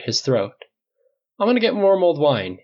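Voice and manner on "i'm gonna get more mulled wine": higher, brisk announcement; announcing. A narrator's reading. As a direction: announce it to the room. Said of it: "he announced".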